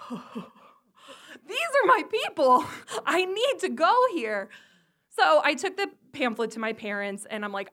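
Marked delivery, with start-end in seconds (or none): 0.1-4.5 high, gushing excitement; thrilled discovery. Retold or imitated. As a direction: act out an awed teen finding her tribe. Teenager's thrilled discovery, acted out.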